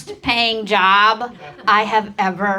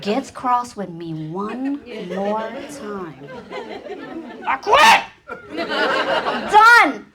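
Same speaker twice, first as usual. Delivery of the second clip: fed-up self-talk; 3 dB quieter, slower than usual. Retold or imitated. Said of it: "Every morning I'm like".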